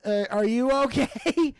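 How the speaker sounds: slow, hesitant, a touch of fear; nervous question. Retold or imitated. Shaky guest asking Buff warily, slow and nervous. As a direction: ask it warily and slowly. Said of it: "I'm, like, shaking".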